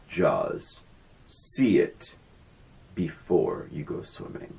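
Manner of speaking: deep, hushed trailer announcer; movie trailer voice. Retold or imitated. Podcast host quoting the Jaws trailer, low hushed announcer read. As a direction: intone it like an old movie trailer. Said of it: "that's the trailer".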